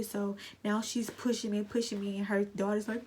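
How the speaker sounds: ordinary talk, nothing marked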